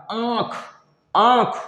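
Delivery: आँख with the vowel pushed through the nose; nasal aankh. Shown as a demonstration. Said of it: "नाक और मुख के दबाव से".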